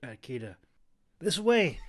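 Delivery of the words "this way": high, loud call to run. A narrator's reading. Clove calling Kita toward the trees. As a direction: shout to her across the camp. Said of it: "he called out to her".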